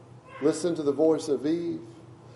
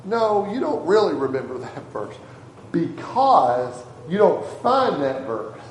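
normal talking voice

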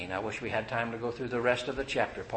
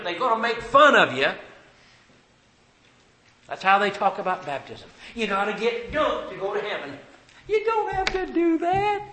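higher, louder sermon voice; full-force preaching; 8 semitones higher, 9 dB louder than usual. Acted. Preacher warning the congregation about false churches, loud and high. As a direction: press it home to the church.

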